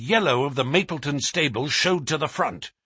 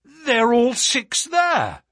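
high, loud, drawn-out call; excited announcement; 11 semitones higher, 5 dB louder, much slower than usual. Acted. Narrator tells the Colonel all horses are running, calling out. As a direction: call it out as a definite statement. Narrator calls out sighting at racecourse.

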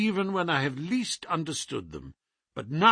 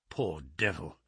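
ordinary delivery

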